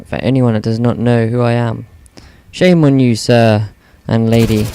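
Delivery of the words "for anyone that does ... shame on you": ordinary talk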